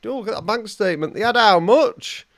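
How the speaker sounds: high, fast gasp of nosy glee; nosy surprise. Pretend. Nosing through a stranger's bank statement, shocked and gleeful. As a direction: gawp at a stranger's spending, rising high.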